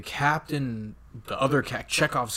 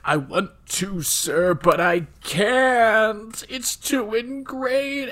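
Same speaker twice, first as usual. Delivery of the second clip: high, strained, hammed-up wail; mock screaming; 10 semitones higher, 4 dB louder, slower than usual. Captain resisting Khan's order, mock screaming. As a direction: imitate an anguished scream, stretching the words. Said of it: "he's, like, screaming".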